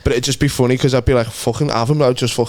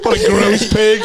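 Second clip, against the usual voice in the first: mock posh voice; 7 semitones higher, slower than usual. Mocking voice.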